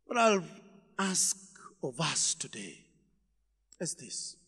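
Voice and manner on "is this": ordinary talk, nothing marked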